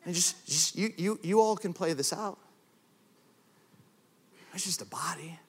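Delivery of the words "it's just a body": soft unvoiced whisper. Real, not acted. Preacher quietly mourning our neglect, whispered. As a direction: breathe it to the room. Whispered after a long pause.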